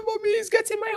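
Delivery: acted distressed daughter. Acting out her tearful complaint to mother.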